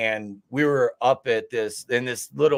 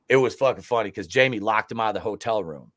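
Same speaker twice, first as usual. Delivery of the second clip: normal talking voice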